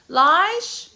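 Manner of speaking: loud high call across the house. Real, not acted. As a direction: call his name from another room. Calling someone to the bathroom.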